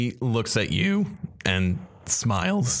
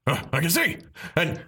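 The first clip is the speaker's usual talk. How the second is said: high sudden realization; startled relief; 11 semitones higher than usual. Acted. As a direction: wake up shocked. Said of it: "He's like".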